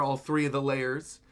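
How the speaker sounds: normal talking voice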